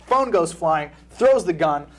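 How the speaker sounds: ordinary talk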